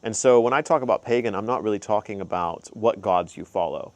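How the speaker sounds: usual speaking voice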